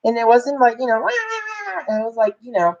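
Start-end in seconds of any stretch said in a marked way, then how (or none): 1.0-2.0 high, soft newborn wail; imitated baby cry. Mother shows the cry she didn't hear, imitated baby wail. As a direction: mimic a baby's wail, softly. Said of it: "I heard him cry".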